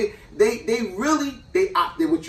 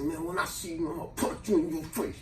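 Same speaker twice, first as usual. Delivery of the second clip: much softer threat under the breath; muttered threat; 11 dB quieter than usual. Retold or imitated. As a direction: mutter it so the aunt can't hear. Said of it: "I was like, I said".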